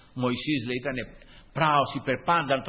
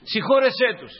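high, louder, pleading forgiveness; fervent plea; 9 semitones higher, 6 dB louder than usual. Acted. Preacher voicing Christ's plea.

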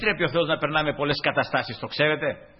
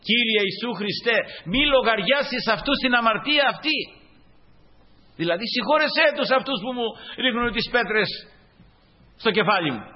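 fervent prayer; 8 semitones higher, 3 dB louder than usual. Acted. Preacher voicing stoned Stephen's prayer.

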